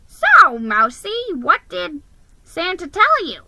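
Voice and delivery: high, loud pushy question; eager elf. Acted. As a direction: pounce on him with the question. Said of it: "he asked".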